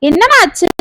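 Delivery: panicked at seeing her cry; mother rushes to the crying girl, alarmed. Acted. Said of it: "பதறிக்கொண்டு".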